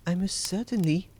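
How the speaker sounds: high voice of a worried wife; anxious wife. A narrator's reading. Wife making excuses to Bluebeard about the key, anxious high voice. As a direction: answer as a wife hiding something, pitched high. Wife answers her husband nervously.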